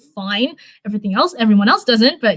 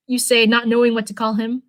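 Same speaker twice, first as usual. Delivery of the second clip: ordinary delivery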